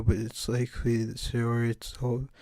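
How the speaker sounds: normal talking voice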